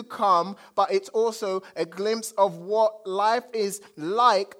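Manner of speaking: loud, ringing sermon proclamation; preaching at full force. Acted. Pastor proclaiming to the congregation, full-force preaching. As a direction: preach it out big to the whole room. Preaching opener, authority of Jesus.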